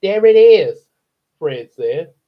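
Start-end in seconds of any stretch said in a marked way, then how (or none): 0.0-0.9 put-on loud, higher kid voice; proud little kid showing off; own childhood voice. Narrator reads Fred as himself as a kid, loud childlike voice. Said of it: "try to sound like how I sounded as a kid".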